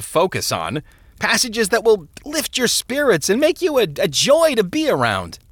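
high, animated, insistent clergyman; minister brushing off worries. A narrator's reading. Minister dismisses a troubled man's fears, animated and reassuring.

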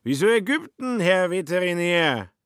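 put-on high, slurred drunken voice; drunk Schmitz. A narrator's reading. Drunk Schmitz questions Kobler in a high voice.

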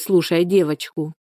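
plain reading voice

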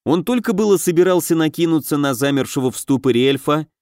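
plain reading voice

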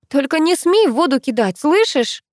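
high-pitched, restrained outcry; mock frantic yell. A narrator's reading. Girl carried toward the sea warns her friend, mock frantic yell. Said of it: "истошно заорала я".

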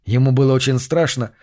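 ordinary delivery, nothing marked

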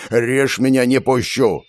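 stubborn refusal, yelled with restraint; understated yell of defiance; yelling back. A narrator's reading. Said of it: "вопила в ответ моя телохранительница".

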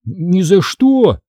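high, drawn-out refusal; stretched, high-pitched protest; blurted refusal. A narrator's reading. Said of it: "вырвалось у меня".

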